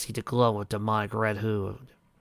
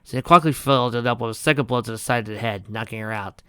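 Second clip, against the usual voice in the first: ordinary delivery, nothing marked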